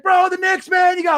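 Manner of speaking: high, loud hyped-up shout; imitating an excited friend texting about the Knicks, high shouting. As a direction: yell it like a fan losing his mind. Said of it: "He's like".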